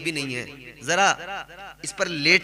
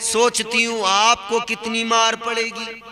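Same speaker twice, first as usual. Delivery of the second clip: slow, raised sermon delivery; preaching storyteller; 6 semitones higher, 5 dB louder, slower than usual. Acted. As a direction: build the tale like a preacher from the pulpit. Preacher tells story with force.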